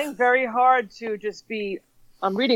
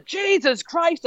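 exasperated groan; 9 semitones higher, much slower than usual. Retold or imitated. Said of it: "It usually starts with a".

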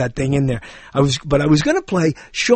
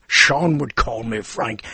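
lower, slow Connery-style line; Sean Connery; 7 semitones lower, slower than usual. Retold or imitated. Imagined Uncle Sean naming his nephew, low slow Connery voice. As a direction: drop low and slow like Connery saying the name. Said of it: "Imagine if Sean Connery is my uncle".

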